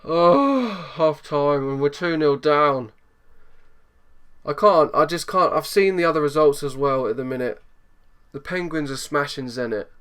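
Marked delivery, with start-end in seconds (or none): none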